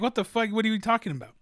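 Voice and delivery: retold, pitched well up in disbelief; imitating a teammate's shocked reaction; mock disbelief. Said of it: "he's like".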